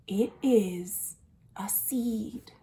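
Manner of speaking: unvoiced hushed reveal; whisper. Shown as a demonstration. Librarian whispering the surprise to young listeners. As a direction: whisper it like a secret.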